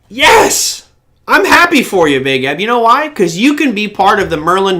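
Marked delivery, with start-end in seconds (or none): 0.1-2.2 high loud joyful yes; celebrating a viewer's bad Apple luck; gleeful cheer. Real, not acted. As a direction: cheer him on, delighted.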